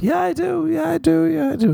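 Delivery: high, insistent fake yes; mock self-reassurance. Lying to yourself about a book, high mock insistence. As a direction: lie to yourself with a squeak. Then you lie to yourself.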